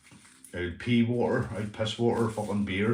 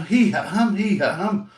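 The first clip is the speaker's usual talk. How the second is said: high, raised mock alarm; mimicked shocked outcry; 9 semitones higher, 6 dB louder than usual. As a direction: mimic stunned mates telling each other. Stunned mates repeat their reaction.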